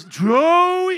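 high, long bellowed name call; top-of-the-lungs shout. Retold or imitated. Imitating the father-in-law calling him, shouting at the top. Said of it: "he just at the top of his".

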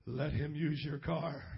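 low, slow mock-heavenly message; angel voice. Angel telling Griselda to lend her car, low solemn mock voice. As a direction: intone it slowly like a visiting angel. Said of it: "like an angel came down from heaven".

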